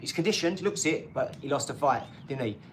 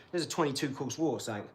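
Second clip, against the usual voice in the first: normal talking voice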